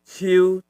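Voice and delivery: ciu level and even; beard tone. Shown as a demonstration. Teacher runs through three tones, ciu level. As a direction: hold ciu on one note.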